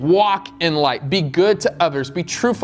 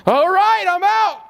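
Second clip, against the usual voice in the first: put-on groggy kid; 14 semitones higher than usual. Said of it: "And I was like".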